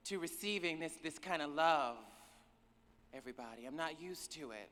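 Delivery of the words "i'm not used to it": ordinary talk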